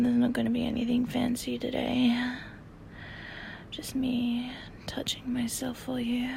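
breathy close-mic whisper. Real, not acted. Intimate whispering throughout.

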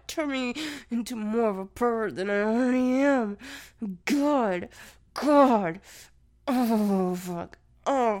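loud, breathless cries of pleasure; loud climactic moaning. Acted. Partner reaching the peak, loud gasping cries.

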